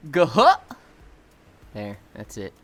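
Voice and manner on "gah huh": sudden loud bear grunt; Banjo impression. Shown as a demonstration. Asked by chat for Banjo, a loud grunt. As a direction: bark out a goofy bear noise. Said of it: "do your best banjo impression".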